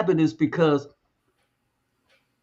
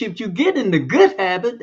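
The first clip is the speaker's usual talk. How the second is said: "good" pushed up in pitch and stressed; emphasis on "good"; 4 semitones higher than usual. Real, not acted. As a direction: lean hard on the word "good". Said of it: "I emphasize that word".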